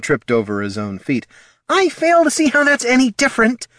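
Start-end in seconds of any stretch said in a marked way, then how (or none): 1.7-3.7 high, tense hissed objection; indignant hiss. A narrator's reading. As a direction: hiss it in exasperation at a colleague. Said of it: "he hissed at the halfling".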